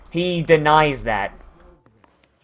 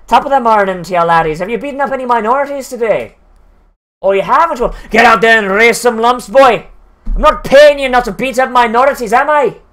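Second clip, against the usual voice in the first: higher, louder hammed-up Irish cop brogue; Irish accent; 4 semitones higher, 4 dB louder than usual. Police boss sending officers out to brutalize, hammed-up Irish accent. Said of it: "that's the thing with the Irish accent".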